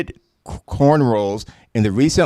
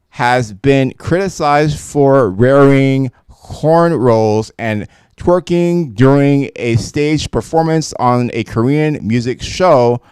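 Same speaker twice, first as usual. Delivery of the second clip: ordinary delivery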